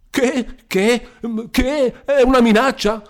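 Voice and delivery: high, loud, panicky stutter; frightened stammer. A narrator's reading. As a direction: stammer in alarm, backing against the wall. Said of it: "balbettò il commendatore".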